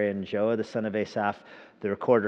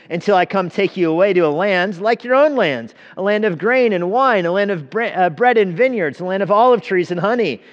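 loud proclamation; 8 semitones higher, 9 dB louder than usual. A narrator's reading. Envoy keeps shouting his offer to the people, loud and high.

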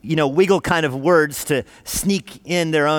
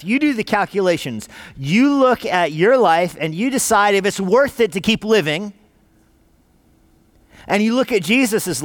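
building exhortation; 5 semitones higher than usual. Acted. Preacher urging listeners to surrender their lives, rising exhortation. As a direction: urge each listener to decide, building.

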